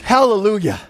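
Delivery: loud, fervent sermon voice; full-force preaching. Acted. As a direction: preach it out to the congregation.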